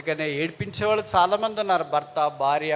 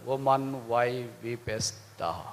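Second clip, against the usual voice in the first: soft, lowered, drawn-out question; gentle words of Jesus; 7 semitones lower, 4 dB quieter, much slower than usual. Acted. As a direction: ask it slowly and tenderly. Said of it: "అందుకే ప్రభు అన్నాడు".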